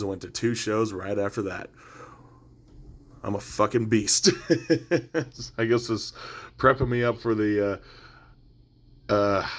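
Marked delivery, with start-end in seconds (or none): 4.3-5.5 short laugh. Real, not acted. Laughs at own boast.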